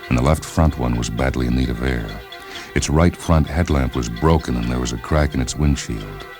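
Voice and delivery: ordinary delivery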